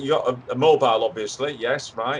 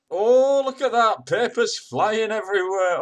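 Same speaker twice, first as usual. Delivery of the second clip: high, excited running commentary; dismayed exclamation; 8 semitones higher than usual. Acted. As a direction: exclaim as the mishap unfolds before you. Watches guest fall, papers fly.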